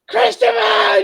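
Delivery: very high, loud mock holler; imitated street yell. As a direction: holler the name down the street like his mates. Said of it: "his lads go".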